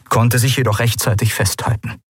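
plain reading voice

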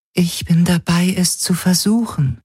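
high, even-pitched Helix voice; Helix, an artifact. A narrator's reading. The Helix answers Galen, high and level.

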